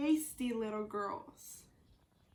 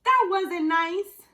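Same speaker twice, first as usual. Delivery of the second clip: growl; 3 semitones higher, 10 dB louder, slower than usual. Acted. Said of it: "the wolf growled".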